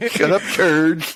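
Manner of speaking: high, giggly; laughing. Real, not acted. Laughing at friend's joke.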